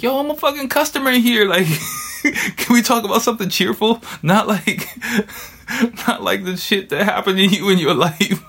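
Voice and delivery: mock-pleading with a chuckle; laughing exasperation. Retelling his own reply, laughing.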